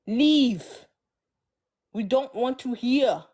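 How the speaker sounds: mock African voice, firm dismissal; African accent. Shown as a demonstration. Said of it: "In my African voice".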